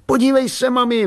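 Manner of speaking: put-on boy calling out high to his mother; high, eager child's shout; excited calling. A narrator's reading.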